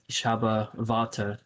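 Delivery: Water said vah-ter, slow; Low German Water. Shown as a demonstration. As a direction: Ich habe Water, W as V, slowly. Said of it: "the W's are pronounced with a V sound".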